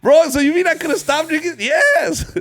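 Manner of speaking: high loud laughing disbelief; outraged disbelief. Retold or imitated. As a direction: shout it, incredulous and laughing. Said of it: "I was like".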